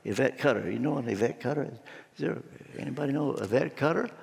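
low, soft, quick murmur; puzzled asking around. Acted. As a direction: ask your neighbours quietly whether anyone knows her. Crowd asking who that is.

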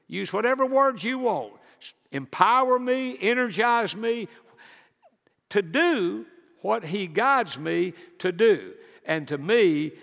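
full-force sermon delivery; fervent preaching. Acted. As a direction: preach it out with growing fire.

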